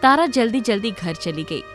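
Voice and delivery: ordinary delivery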